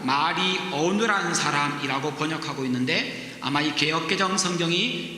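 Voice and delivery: ordinary delivery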